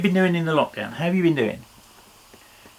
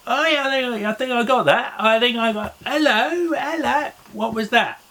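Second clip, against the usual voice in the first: mock amazement; 9 semitones higher than usual. Hears the children's shout.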